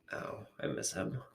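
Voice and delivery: softly grieving, barely audible; sad and quiet. Real, not acted. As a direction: let it slip out, hushed and aching. Missing late friend.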